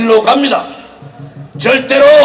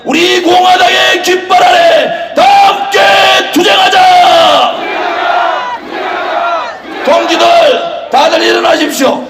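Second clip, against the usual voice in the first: shouted rally slogans, higher pitch; roaring rallying speech; 5 semitones higher than usual. Acted. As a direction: rouse the crowd with chants. Rally leader chanting to crowd.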